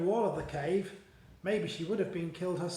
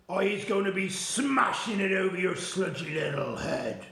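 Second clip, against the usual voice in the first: threatening Blood Butler; 4 dB louder than usual. Acted. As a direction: warn him with heavy threat. Giant threatens BFG.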